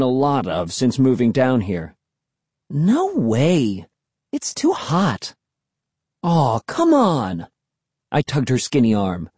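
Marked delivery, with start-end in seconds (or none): 2.7-5.3 high, pouty big sister; slow, whiny no; pouting refusal. A narrator's reading. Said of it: "Emily made her pouting face". 6.2-7.5 put-on drawn-out, higher-pitched kid coaxing; pleading little brother. A narrator's reading. Kid coaxing his reluctant sister.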